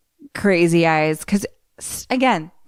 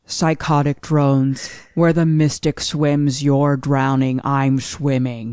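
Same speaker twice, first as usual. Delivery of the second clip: slow, low robotic monster voice; 3 semitones lower, slower than usual. Acted. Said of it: "a robot monster voice a little bit".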